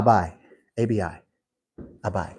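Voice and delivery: Abai said ah-BYE, then spelled A B I; Hebrew word Abai. Shown as a demonstration. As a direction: say Abai, stress on the last syllable. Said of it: "he says, Abba".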